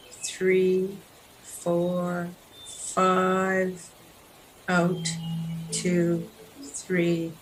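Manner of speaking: guided breathing count. Real, not acted. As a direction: count the breath gently in and out with space between numbers.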